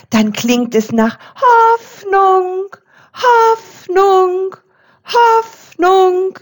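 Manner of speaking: very high, slow chiming Hoffnung; proud high chime. A narrator's reading. The little bell proudly chimes her word, very high. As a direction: toll Hoffnung like a small bright bell. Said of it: "sagte die kleinste Glocke stolz".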